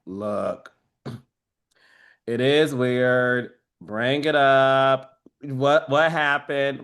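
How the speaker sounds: low, very slow mock voice; imitating Alexis. Castmate quoted saying it is weird, low slow imitation. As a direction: drop the pitch and drag each word out. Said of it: "Alexa's like".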